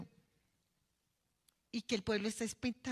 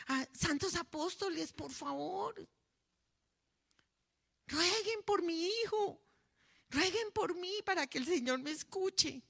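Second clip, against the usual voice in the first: rising desperate plea; 6 semitones higher than usual. Shown as a demonstration. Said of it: "usted también acérquesele a la sotana".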